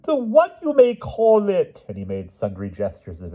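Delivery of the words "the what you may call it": very high, slow, knowing hint; conspiratorial hint. A narrator's reading. Pellinore hinting at the queen, slow and high. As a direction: drag it out with heavy meaning.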